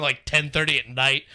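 usual speaking voice

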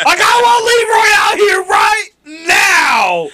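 slow, high, loud shouted callout; wrestling promo. Retold or imitated. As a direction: yell it like a wrestler demanding a match. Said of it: "Like a wrestling promo".

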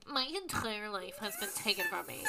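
nasal-free but pretentious, whiny Squidward take; Squidward impression. Host mimicking Casey like Squidward, mock pretentious. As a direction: sulk it like Squidward. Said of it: "in Casey's voice ... Like fucking Squidward".

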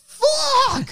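shocked, pitch jumps high, half laughing; squealed high laughing curse. Retold or imitated. Caught forgetting the relative, a laughing high-pitched curse. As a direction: blurt it out in mock horror.

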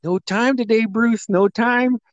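high, drawn-out imitation of a friend; joking brush-off. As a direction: brush him off in a high, slow mock voice.

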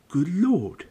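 high surprised gasp of words; startled old barman; amazed old man. Acted. Bartender exclaims.

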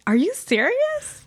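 high, drawn-out disbelief; Are you serious, up high and slow; shocked. Real, not acted. Shocked at song meaning.

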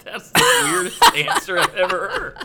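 cracking up at a ridiculous pick; laughing disbelief. Real, not acted. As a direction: laugh out your shock at the answer. Incredulous at the odd answer.

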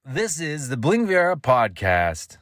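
high, slow, swooping ident; big drawn-out announcer take on "This is the Bling Viera podcast"; announcer inflection. Shown as a demonstration. Podcaster finishing his practice, grand announcer inflection. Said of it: "No more practicing on this platform".

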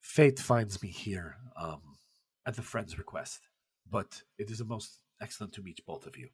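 put-on a gracious gentleman with a soft foreign accent, caught off guard; Pessoa's accent. Poet greeting the admiring women, soft foreign-accented courtesy. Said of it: "shocked to hear another accent that isn't Russian".

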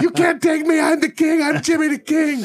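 very high-pitched taunting boast; Jimmy the King. Retold or imitated. Cab driver taunts rival drivers, high mock boasting. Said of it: "he's taunting these other cab drivers".

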